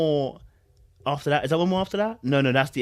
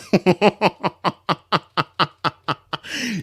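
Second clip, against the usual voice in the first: laughing; 4 semitones higher, 5 dB louder than usual. Real, not acted. Keeps laughing in disbelief.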